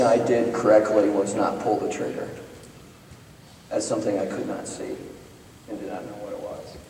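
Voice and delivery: quiet, sober confession; hushed. Real, not acted. Preacher admitting he nearly fired, quiet and grave. Sober reflection after story.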